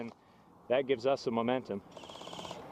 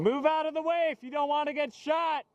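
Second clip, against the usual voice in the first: raised warning shout; 11 semitones higher than usual. Real, not acted. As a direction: yell a warning across the field. Yelling at players in the way.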